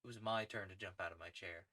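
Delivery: ordinary delivery, nothing marked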